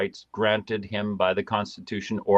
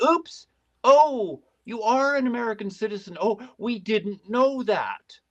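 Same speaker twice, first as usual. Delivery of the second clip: mock innocent surprise; 9 semitones higher than usual. Agents discovering they detained an American citizen, mock innocent surprise. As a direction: feign sudden innocent realization, pitched way up.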